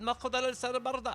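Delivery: high, loud, rapid-fire gibberish rant; imitated family argument in fast made-up language; mock foreign gibberish. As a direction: yell nonsense as if arguing in another language. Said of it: "I was speaking gibberish".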